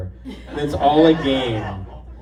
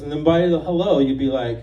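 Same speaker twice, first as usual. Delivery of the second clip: usual speaking voice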